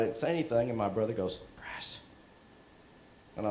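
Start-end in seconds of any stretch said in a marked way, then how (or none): 1.5-2.0 hushed call of a name in the dark; whispered call. Retold or imitated. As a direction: whisper the name to wake him. Brother waking him at night.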